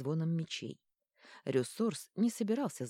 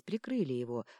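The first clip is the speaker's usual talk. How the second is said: ordinary delivery, nothing marked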